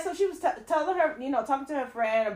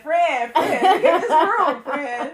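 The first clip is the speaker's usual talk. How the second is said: loud excited pleading; 4 semitones higher, 6 dB louder, much slower than usual. Retold or imitated. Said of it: "she was like".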